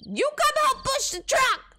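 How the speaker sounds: high, loud scolding; angry yelling. Acted. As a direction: bark the order. Yelling at friends.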